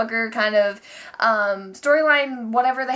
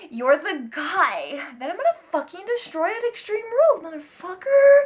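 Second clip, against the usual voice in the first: mock threat; 6 semitones higher than usual. A J threatening Roman in recap.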